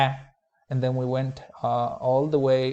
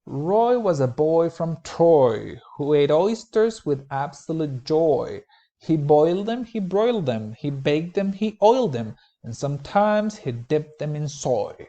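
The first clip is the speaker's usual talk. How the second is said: reading a limerick; 2 semitones higher, 3 dB louder than usual. Acted. Teacher performs a silly limerick for the class, playful. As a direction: read the rhyme with bouncy fun. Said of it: "So here I go".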